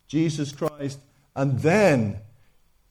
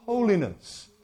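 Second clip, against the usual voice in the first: ordinary delivery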